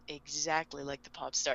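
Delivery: low, soft imitated reply; deadpan. L confirming his alias to Light, low deadpan. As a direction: answer quietly without a hint of joking.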